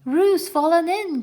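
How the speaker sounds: a loud, slow cry of alarm; shouting a warning, drawn out. A narrator's reading. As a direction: call out that Roo has fallen in. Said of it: "cried Rabbit".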